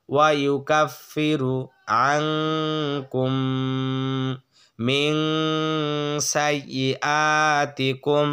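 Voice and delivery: ওয়া ইউকাফিরু আংকুম chanted slowly with a long nasal ghunna; slow Quran recitation. Shown as a demonstration. Teacher recites the verse slowly for students. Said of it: "আমার সাথে একবার পড়েন".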